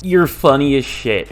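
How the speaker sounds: slight southern twang; southern accent. Real, not acted. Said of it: "gained, like, a southern accent there".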